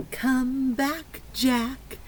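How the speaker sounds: ordinary talk, nothing marked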